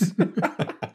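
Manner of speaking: breathy laugh after choking; choked laugh. Real, not acted.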